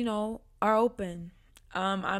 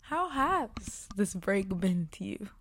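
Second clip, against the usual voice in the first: usual speaking voice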